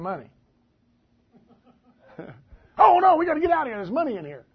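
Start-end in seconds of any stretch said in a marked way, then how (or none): 2.8-4.4 loud, high, rushed fake alarm; mock panic. Preacher joking about fearing money, mock panic. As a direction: blurt it out in hammed-up panic. Mocks being afraid of money.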